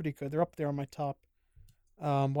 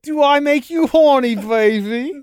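much higher, slow, put-on cartoon voice; cartoon catchphrase; 10 semitones higher, 11 dB louder, slower than usual. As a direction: ham up the silly cartoon line, slow and loud. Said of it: "I got it".

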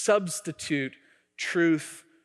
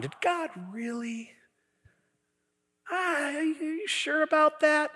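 mocking enemy; 12 semitones higher than usual. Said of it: "The enemy wants you".

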